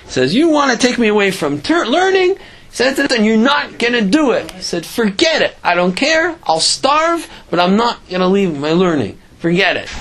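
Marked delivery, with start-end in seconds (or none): 0.3-2.4 high-pitched, indignant retort; retold indignation, pitch climbing sharply; defiant challenge. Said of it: "smacked his fist on the table". 3.1-4.5 higher-pitched, firm retort; retold table-thumping defiance; defiant refusal. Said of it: "smacked his fist on the table". 4.8-9.9 high-pitched, emphatic dismissal, settling at the end; retold stubborn defiance; defiant refusal. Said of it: "smacked his fist on the table".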